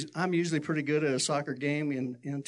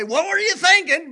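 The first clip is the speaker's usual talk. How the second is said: mock scolding; 13 semitones higher, 12 dB louder than usual. Hypothetical scolding.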